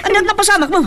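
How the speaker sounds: high frantic calls of her name; frantic high cries; panicked shouting. Acted. Panicked husband shouts wife's name.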